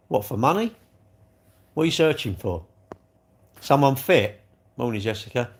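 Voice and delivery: normal talking voice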